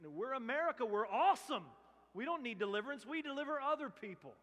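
loud, high, puffed-up mock pride; mock boast. Preacher mocking the nation's pride with a loud mock boast. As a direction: ham up the arrogant boasting.

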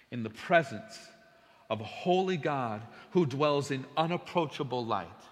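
ordinary talk

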